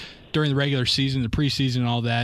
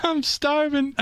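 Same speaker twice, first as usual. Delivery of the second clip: mock high echo; 13 semitones higher, slower than usual. Host mimics the impression.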